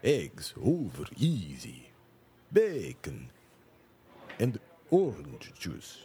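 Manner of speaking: put-on slow, drawn-out old vampire accent; Lugosi vampire voice. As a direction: savour each word like Dracula. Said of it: "stereotypical Romanian Lugosi era vampire voice".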